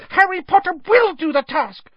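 loud, insistent high squeak; very high-pitched emphatic elf; Dobby. A narrator's reading. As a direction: squeak it with fierce resolve. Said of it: "squeaked the elf".

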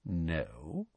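very soft, low no. A narrator's reading. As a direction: say it softly and low. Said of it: "said Snape softly".

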